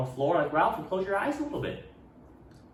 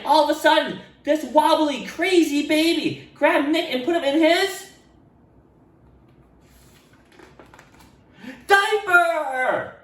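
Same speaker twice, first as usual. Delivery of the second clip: higher, louder storytelling; dramatic build; 5 dB louder than usual. A narrator's reading. Narrating the baby grabbing Nick, high and dramatic. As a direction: build the suspense.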